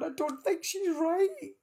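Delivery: high, slow fretting voice; mock-fret to the doctor; high concerned friend impression; worried friend aside.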